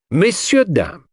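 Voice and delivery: high, showman-like address to the room; with a flourish. Acted. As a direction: present it like a triumphant showman. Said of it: "said Poirot with a flourish".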